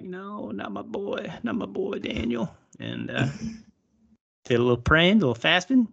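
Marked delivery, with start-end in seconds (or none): 0.0-2.5 high, slow mock wail; hammed-up high, slow lament for Daniel; mock anguish. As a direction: ham up the king's grief. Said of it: "king was like".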